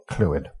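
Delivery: "Clwyd" said as "CLOO-id"; Welsh name demo. Shown as a demonstration. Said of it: "There's no vowels in there".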